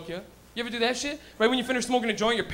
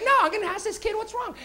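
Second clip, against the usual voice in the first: wife; 11 semitones higher than usual. Retold or imitated. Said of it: "the wife's like".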